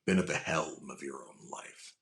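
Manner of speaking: a soft, measured crime boss; Raymond Reddington voice. Retold or imitated. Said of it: "like, Raymond Reddington from The Blacklist".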